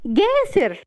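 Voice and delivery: high, delighted call; happy. A narrator's reading. Rawan spotting Jasser at the door, overjoyed. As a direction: call his name with soaring joy. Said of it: "وتقول بسعادة".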